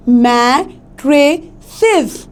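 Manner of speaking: mattresses very slowly, as mat, tre, ses; syllable-by-syllable stress. Shown as a demonstration. Teacher giving example of stressed syllables, mattresses slowly broken up. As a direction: split mattresses with pauses between syllables. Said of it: "वो थोड़ा ऊंचा सुनाई पड़ेगा".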